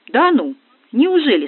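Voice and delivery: high, loud, slow mock astonishment; sarcastic. A narrator's reading. Дегтярев mocks the forgetful friend, sarcastically. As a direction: draw it out in scornful disbelief. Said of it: "съехидничал Дегтярев".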